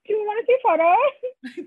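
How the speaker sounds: high, excited offer through laughter; laughing mock eagerness. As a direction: pester them gleefully, cracking up. Pretends pestering captors, laughing.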